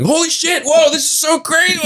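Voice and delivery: high, giddy amazement; excited. Retold or imitated. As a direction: squeal with delight. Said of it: "They would just hear me going".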